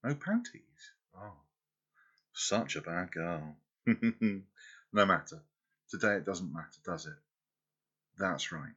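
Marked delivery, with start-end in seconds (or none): 3.8-4.4 playful laugh. Acted. Lover amused at her girl.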